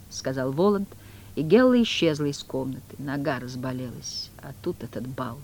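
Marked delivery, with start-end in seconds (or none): 3.0-5.4 Woland complaining. Acted. Woland complaining to Margarita of his sore leg, weary. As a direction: grumble tiredly about the leg.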